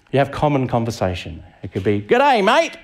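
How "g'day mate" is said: high-pitched, hearty Australian "g'day"; Australian greeting. Acted. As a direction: greet a mate in a hammed-up Australian accent. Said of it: "if you're Australian".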